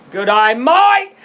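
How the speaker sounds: Aussie twang shooting up on "mate"; loud, rising Australian accent. Shown as a demonstration. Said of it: "without sounding Australian".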